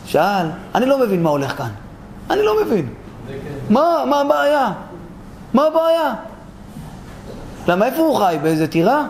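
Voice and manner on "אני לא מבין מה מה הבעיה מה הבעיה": high, exasperated repetition; baffled protest. Acted. Speaker not understanding what the problem is, exasperated. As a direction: push the bafflement up high in pitch. Same question repeated, escalating in bafflement.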